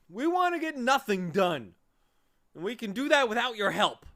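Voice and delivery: high and loud, indignant; angry snap. Acted. Lethargarian rebuffing Milo's help, loud and high. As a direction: throw it back at him. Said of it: "snapped another angrily".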